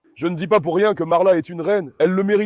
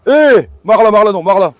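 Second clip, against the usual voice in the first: loud, raised command; urgent calling out; 3 semitones higher, 9 dB louder than usual. Real, not acted. Owner stopping Marla from attacking, urgent loud call. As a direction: shout to stop a dog.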